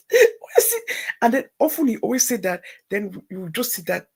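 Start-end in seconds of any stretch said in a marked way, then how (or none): 0.1-0.9 high laughing cry, slow; laughing disbelief. Real, not acted. Laughing at husband's faith.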